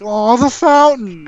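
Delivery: high, slow wail of sadness; mock lament. Game master voicing sad townspeople at broken fountain, hammed-up lament. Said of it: "You hear the sounds of sad people".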